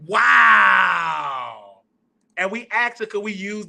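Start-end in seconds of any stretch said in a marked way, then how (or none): 0.1-1.8 long, loud drawn-out wow; real awe, belted and held; amazed. Real, not acted. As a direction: stretch the word out in amazement. Amazed at an old record.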